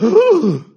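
sharp, high gasp of shock. Retold or imitated. Disciples hearing Jesus insult the leaders, shocked gasp. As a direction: gasp like the words just hit you. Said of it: "The disciples were like".

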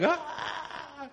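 sudden high cry of someone overcome; imitated cry. Pastor imitates a girl on the floor with a high cry. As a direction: let out a high "Ah!" as if overwhelmed. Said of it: "she was just on the floor like".

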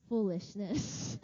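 low, drawn-out verdict; mock dismissal. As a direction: imitate a preacher slowly dismissing it. Retold preacher's dismissive verdict.

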